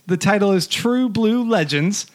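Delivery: ordinary talk, nothing marked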